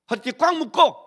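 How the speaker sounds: sudden loud high exhort; emphatic call. Real, not acted. Preacher urging the sulking congregation to stand firm, loud exhortation. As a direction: punch it out like a rallying cry.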